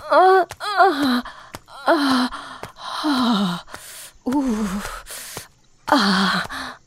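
breathless moans building up, voice cracking; moaning. Acted. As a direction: let the moans climb, gasping between words.

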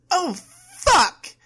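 high, loud anguished outburst; shout. A narrator's reading. Overwhelmed woman cries out as her body burns, shouting. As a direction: shout it out at full voice. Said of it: "Amy shouted".

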